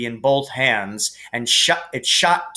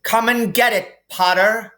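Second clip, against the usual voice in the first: much higher, louder taunting call from above; 9 semitones higher, 5 dB louder than usual. A narrator's reading. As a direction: dare him to come up. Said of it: "he called".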